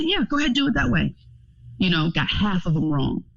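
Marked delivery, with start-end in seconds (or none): 0.0-1.1 sarcastic go ahead. Retold or imitated. Sarcastic permission to daughter.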